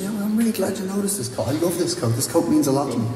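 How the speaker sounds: a hint of an older woman fondly talking about her coat; older lady's voice. Elderly lady telling about her coat.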